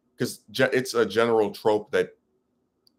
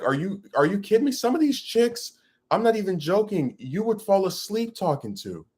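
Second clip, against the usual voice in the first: incredulous; 9 semitones higher than usual. Real, not acted. Incredulous rant.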